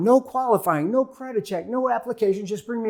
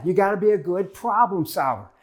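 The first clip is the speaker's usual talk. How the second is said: normal talking voice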